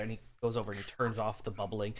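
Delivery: ordinary delivery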